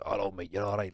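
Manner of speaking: lightly imitated thick Scouse greeting; strong Scouse accent. Man imitating his mate chatting to French bar staff, put-on Scouse accent. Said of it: "he put a proper strong Scouse accent on".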